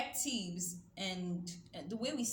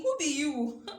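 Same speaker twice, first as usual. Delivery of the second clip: Nigerian pidgin challenge, a touch louder with a laugh; 6 semitones higher, 8 dB louder than usual. Retold or imitated. Preacher teasing the congregation in Nigerian pidgin. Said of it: "in Nigerian pidgin".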